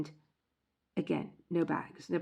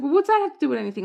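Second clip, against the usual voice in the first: husband; 9 semitones higher, 11 dB louder, much faster than usual. Retold or imitated. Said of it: "And he's like".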